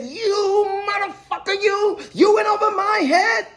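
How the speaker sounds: high, drawn-out outraged impression; Joe Pesci. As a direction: stretch each accusation high in mock fury. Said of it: "He gave me the Joe Pesci".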